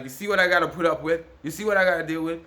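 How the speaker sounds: loud, fast, high complaint; exasperated appeal. Retold or imitated. To the bus driver about the man, a loud fast exasperated complaint. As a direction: vent loudly at the bus driver. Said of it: "I turn to the bus driver and I'm like".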